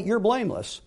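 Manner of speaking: normal talking voice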